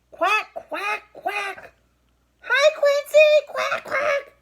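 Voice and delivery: teacher voicing Dottie Duck shouting her hello. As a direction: squawk it out like a noisy duck. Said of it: "shouts Dottie".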